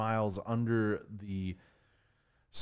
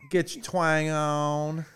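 twang; 5 semitones higher, 4 dB louder, slower than usual. Shown as a demonstration. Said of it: "you gotta define what a twang is".

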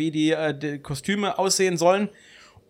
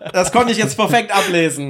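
ordinary talk

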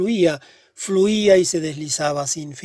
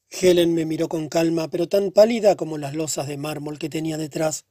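plain reading voice

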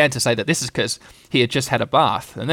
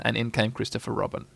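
ordinary delivery, nothing marked

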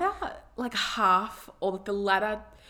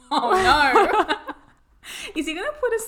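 laughing; 10 semitones higher, 8 dB louder than usual. Real, not acted. Laughing reaction.

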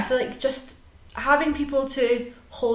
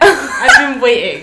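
mock sulky complaint; 2 semitones higher, 11 dB louder, much slower than usual. Teasing friend for being left out.

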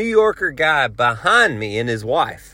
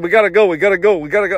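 higher, pushy repeated urging; mock urgency, rattled off insistently; urgent urging; 4 semitones higher, 3 dB louder, much faster than usual. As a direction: imitate an impatient man shoving forward. Pushing man urging them on.

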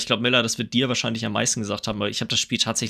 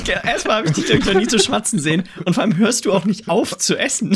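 laughing; 8 semitones higher, 5 dB louder than usual. Real, not acted. Laughing at chewing co-host.

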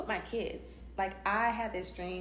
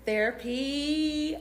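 "therapy" slow, higher, louder; drawn-out answer; 8 semitones higher, 6 dB louder, much slower than usual. Real, not acted. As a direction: stretch out "therapy" for effect. Dramatic one-word answer.

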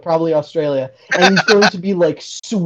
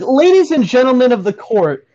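higher, louder pompous lawyer opening; grand courtroom address; 6 semitones higher, 5 dB louder than usual. Retold or imitated. Friend mocking a defendant addressing the court, higher, louder and pompous. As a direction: boom it out to the jury. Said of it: "he's like".

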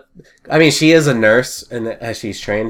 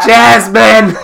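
loud, high holler for a housemate; calling out; 10 semitones higher, 9 dB louder than usual. Acted. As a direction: yell the name through the house.

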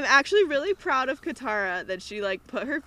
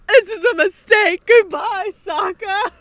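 much higher and louder, hammed-up farewell; mock-melodramatic breakup line; dramatic princess; 10 semitones higher, 12 dB louder, slower than usual. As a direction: overplay the tragic goodbye. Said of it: "Wow, Yue is so dramatic".

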